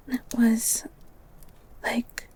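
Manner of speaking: soft breathy recounting of the book; dreamy whisper. Acted. Girl dreamily tells the beast about the book, whispering. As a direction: whisper as if lost in the story.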